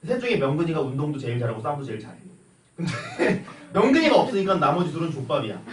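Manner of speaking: ordinary delivery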